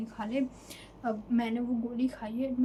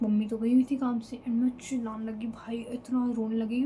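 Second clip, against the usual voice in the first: ordinary delivery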